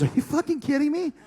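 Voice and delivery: high, disbelieving outburst at the boss; incredulous outburst. Retold or imitated. Retelling his outrage to Michael Hayes, high and incredulous.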